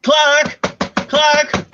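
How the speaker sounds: high calling clerk. Acted. Lady summoning the hotel clerk, high calling. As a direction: call across the lobby to the clerk.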